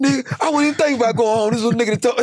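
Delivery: high, loud, laughing put-on voice; laughing mock voice. Inmate laughing at him, high put-on voice. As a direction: cackle through the words.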